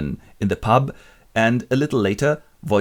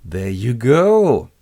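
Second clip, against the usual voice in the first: very slow odd captain voice; slow mock gruff drawl; 2 semitones higher, 6 dB louder, much slower than usual. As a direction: drag it out, smug. Acted captain line.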